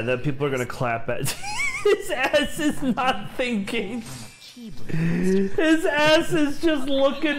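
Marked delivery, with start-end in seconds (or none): 1.3-7.4 high, slow, words through laughter; laughing. Real, not acted. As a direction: wheeze it out while cracking up. Cracking up over the cat.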